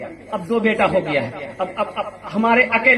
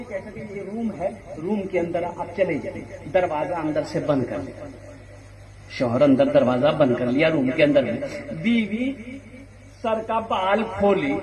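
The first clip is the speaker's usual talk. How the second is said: ordinary talk, nothing marked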